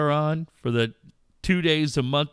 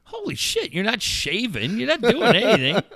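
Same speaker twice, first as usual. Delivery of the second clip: mock exasperation; 10 semitones higher than usual. Dad scolding son about his long shower, in high mock exasperation. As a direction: squeal it in mock horror. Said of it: "What the fuck are you doing".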